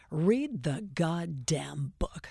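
slow, whispered put-down; contemptuous whisper. Retold or imitated. Grumpy author to nervous interviewer, slow scornful whisper. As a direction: hiss it slowly like a cold brush-off.

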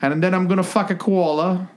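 high, hammed-up Aussie; Australian accent. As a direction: play a cartoon Australian croc wrestler. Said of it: "still, still Australian".

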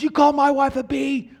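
high, loud furious yell over the fence; angry yell. Retold or imitated. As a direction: bellow it like an enraged neighbour shaking the fence. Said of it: "the neighbor's yelling this whole time".

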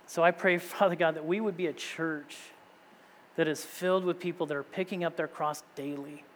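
earnest, measured prayer voice; steady pastor's prayer, softening toward the end; closing prayer. Acted. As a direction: pray over the church sincerely.

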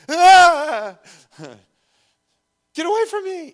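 high, loud hammed-up scream; mock panicked shriek. Pastor acts a coward singled out, mock panicked shriek. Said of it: "You single someone out".

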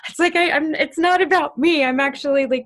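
high, loud, overjoyed gush; gushing joy. Retold or imitated. Telling a friend's good news, gushing high and loud. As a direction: squeal how happy she is for them. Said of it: "I will actually start crying".

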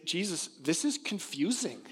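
high, slow bewildered question; puzzled disciples. Retold or imitated. Disciples ask Jesus, puzzled.

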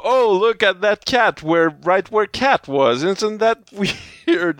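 hammed-up clueless surprise; mock wonder. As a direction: stretch it out high in dim amazement. Voicing oblivious characters spotting the cat.

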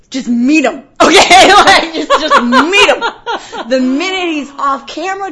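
high, loud, laughing insistence; exasperated pleading. Retold or imitated. Producer bargaining with cops for five minutes, exasperated. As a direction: stretch it out in comic desperation.

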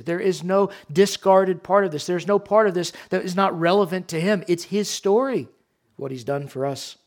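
ordinary talk, nothing marked

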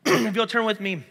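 slightly hoarse, catching throat; frog in throat. Real, not acted. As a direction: speak through a frog in the throat. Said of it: "I got a little frog in my throat".

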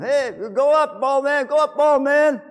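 put-on high, taunting children's jeer; mocking kids. Children taunting the old prophet, high and mocking. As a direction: jeer like cheeky kids in the street.

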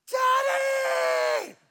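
very high, loud drawn-out shriek; imitated little girl's piercing cry; child's scream. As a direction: scream for Dad like a stranded child. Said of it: "So she screams my name".

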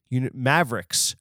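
ordinary delivery

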